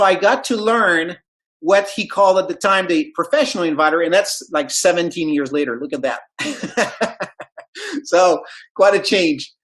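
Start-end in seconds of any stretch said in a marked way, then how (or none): 6.2-8.0 short, soft laugh; light self-amused laughter. Real, not acted. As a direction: chuckle quietly at your own story. Laughs at his own story.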